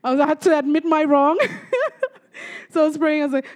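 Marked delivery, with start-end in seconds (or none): 1.4-2.1 short, high giggle; real laugh. Real, not acted. Speaker laughs at having to admit their wrong, high and amused. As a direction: let out a quick high chuckle.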